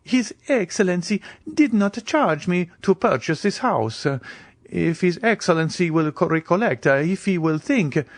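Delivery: a nervous servant deflecting; strange expression. A narrator's reading. Bertuccio avoids the Count's question, uneasy and high. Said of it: "with a strange expression".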